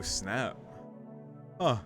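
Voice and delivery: ordinary talk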